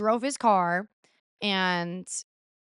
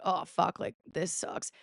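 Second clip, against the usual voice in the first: low, whispered grumble; muttering; 3 semitones lower, 8 dB quieter, slower than usual. Retold or imitated. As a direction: grumble it under your breath. Dad woken, going downstairs.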